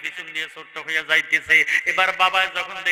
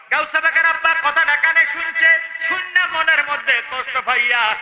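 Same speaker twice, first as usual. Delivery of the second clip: full-force preaching; 11 semitones higher, 5 dB louder than usual. Acted. Preacher telling how the father heard it, high chanted preaching. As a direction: launch high and loud, then come down.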